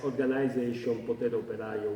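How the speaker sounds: ordinary talk, nothing marked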